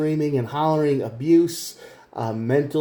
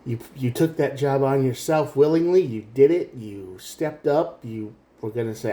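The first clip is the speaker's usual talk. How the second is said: normal talking voice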